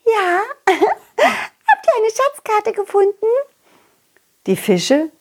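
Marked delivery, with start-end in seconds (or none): 0.1-3.3 high, slow fish voice with a giggle; a fish leaning in, curious and giggling; eager little fish. Acted. Fish asks about map.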